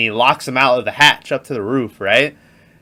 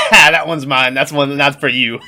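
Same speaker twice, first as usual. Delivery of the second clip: retold gloating laugh; 2 semitones higher, 3 dB louder than usual. Retelling the agent teasing the vault guy, laughing imitation. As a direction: imitate a prankster laughing through the line.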